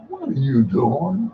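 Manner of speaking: low, questioning; voice of God. Acted.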